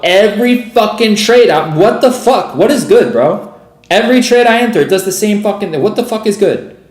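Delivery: high-pitched, indignant venting; exasperated outburst. Real, not acted. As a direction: blurt it out in real disbelief and frustration. Trade fails, trader blows up.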